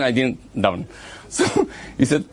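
ordinary talk, nothing marked

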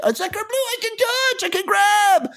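high mock French accent. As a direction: ham it up like a cartoon Frenchman. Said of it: "he's probably like".

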